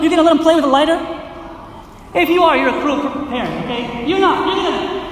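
usual speaking voice

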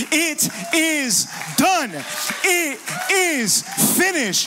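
loud, slow, high victory cry; shout of triumph. Acted. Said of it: "It was a shout of triumph".

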